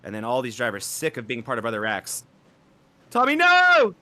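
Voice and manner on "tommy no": high, very loud cry of dismay; alarmed yell. Real, not acted. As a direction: yell out in horror at the crash. Caller cries out at crash.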